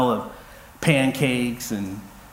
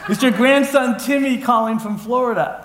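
higher and louder through a laugh; laughing mock yell; 8 semitones higher, 3 dB louder than usual. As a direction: yell it to grandma while cracking up. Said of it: "you're yelling at your grandmother".

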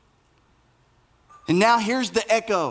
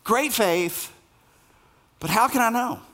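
mocking doubt; 4 semitones higher than usual. Shown as a demonstration. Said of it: "For a man of great faith, it seems like he has quite a bit of doubt".

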